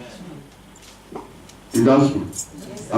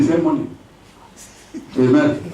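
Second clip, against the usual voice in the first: usual speaking voice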